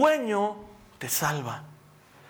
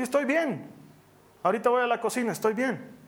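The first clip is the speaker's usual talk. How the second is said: higher voice carrying to another room; calling out; 4 semitones higher than usual. Retold or imitated. Father answering mother and wife from the dark, calling out. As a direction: call back that all is fine.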